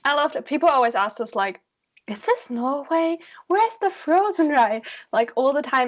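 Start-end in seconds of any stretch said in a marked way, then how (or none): 2.1-4.8 higher, slow questioning; lightly voiced tourist; confused tourist. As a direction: ask it lost and eager.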